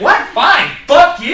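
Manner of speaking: a hero suddenly huffy and rude; mock offended outburst. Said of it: "He's like".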